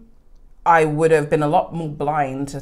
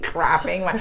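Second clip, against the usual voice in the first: usual speaking voice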